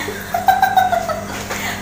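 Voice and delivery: squealing high laugh. Real, not acted. Laughing at the spoon. (mataas na tawa)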